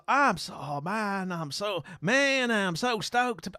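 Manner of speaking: over-keen mock American; bad American accent. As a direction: gush in a failed American drawl. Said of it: "I can't do the accent".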